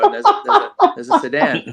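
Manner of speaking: laughing in disbelief; breaking into a laugh. Real, not acted. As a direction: laugh through the words, amazed. Seeing the sedan out front.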